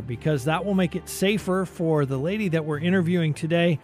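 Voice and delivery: usual speaking voice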